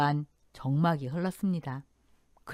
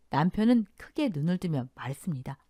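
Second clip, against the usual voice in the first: ordinary delivery, nothing marked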